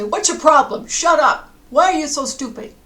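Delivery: loud, high scolding yell; furious acted telling-off, hard and loud; angry yelling. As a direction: yell at the dog as if fed up. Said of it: "Would you yell at the dog".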